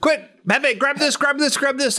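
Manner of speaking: high, hurried mock commands; urgent orders. Man arming up for the chase, urgently ordering. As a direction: rattle off frantic orders.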